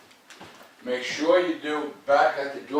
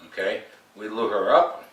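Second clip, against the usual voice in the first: ordinary talk, nothing marked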